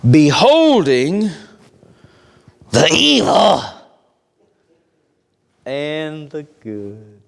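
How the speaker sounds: slow, hushed, half-whispered scripture; slow reverent reading, dropping to a whisper; solemn scripture reading. Acted. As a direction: draw each word out like a holy secret. Reads scripture slowly, hushed.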